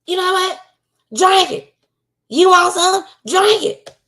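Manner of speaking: high, mocking grandma voice daring the child; squeaky, sarcastic "go ahead, drink it"; exasperated grandma. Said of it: "she probably was like".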